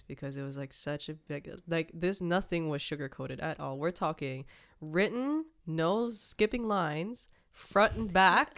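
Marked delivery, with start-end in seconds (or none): 3.8-8.6 slow and rising, higher and louder at the end; building emphasis on how long the letter was; slow emphatic build. Real, not acted. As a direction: lay each phrase out slowly for effect.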